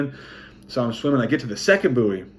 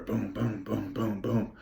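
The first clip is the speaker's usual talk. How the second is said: quiet rhythmic boom sounds; soft rhythmic booms; 9 dB quieter, much slower than usual. Retold or imitated. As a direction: thump it out softly, steady beat. Imitating swim strokes.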